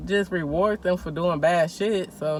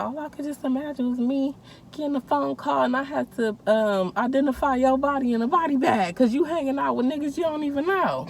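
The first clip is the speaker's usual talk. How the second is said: a higher, lightly voiced mom lecturing; imitating her scolding mom; 6 semitones higher than usual. Mom scolding her daughter over the lie, a lightly mocked higher voice. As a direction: play mama, half-mocking her worry.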